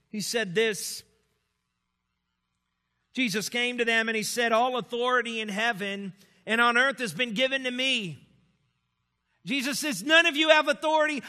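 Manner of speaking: loud, impassioned sermon voice; preaching at full force. Acted. Pastor preaching to his congregation about the church's self-focus, impassioned and loud. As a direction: drive the point home to the pews.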